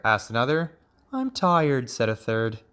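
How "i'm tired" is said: high little frog complaint; tired frog child. A narrator's reading. Young frog on the long flight, high weary complaint.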